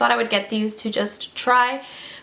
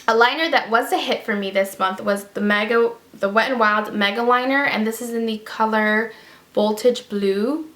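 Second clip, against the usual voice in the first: ordinary talk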